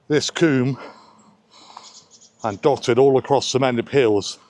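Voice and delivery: breathless from the steep climb; puffing while walking uphill; out of breath. Real, not acted. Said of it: "Excuse me for sounding out of breath".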